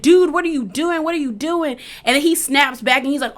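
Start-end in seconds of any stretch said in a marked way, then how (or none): 0.0-1.8 higher-pitched alarmed guard; mock alarm. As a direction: voice a guard demanding an explanation. Retold guard running up alarmed.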